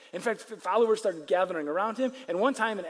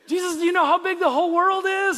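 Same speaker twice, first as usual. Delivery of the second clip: high, loud, disbelieving cry; frantic shout; 11 semitones higher, 8 dB louder than usual. Retold or imitated. Peter shouting at Jesus about the whole world, mock panic.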